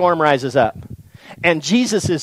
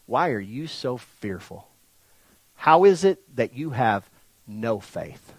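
low, slow, measured questioning; soft and deliberate, leaving space between phrases; quiet reproach; 6 semitones lower, 10 dB quieter, slower than usual. Acted. Jesus turning round to his fearful disciples, quiet reproach.

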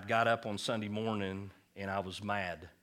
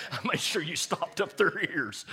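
laughing; 9 semitones higher, 7 dB louder than usual. Real, not acted. God's punchline said laughing.

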